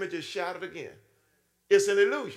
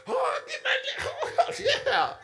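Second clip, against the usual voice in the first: much higher, laughing squeal; hammed-up joy at getting cash; gleeful delight. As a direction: giggle over the money. Delight at money in pocket.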